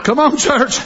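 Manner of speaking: usual speaking voice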